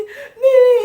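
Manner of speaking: high, teary, half-laughing yes; tearful yes. Retold or imitated. Suk-hyang answering her future mother-in-law, teary. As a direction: answer through happy tears.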